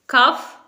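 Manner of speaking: कफ as "kuff"; common mispronunciation. Shown as a demonstration. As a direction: ask कफ with short uh vowel. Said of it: "wrong".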